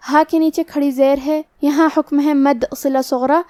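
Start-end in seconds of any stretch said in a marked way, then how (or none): none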